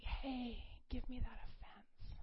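soft, half-whispered retold invitation; gentle coaxing. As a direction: coax softly, imitating a kind God. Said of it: "it's not like".

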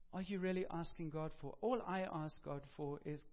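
normal talking voice

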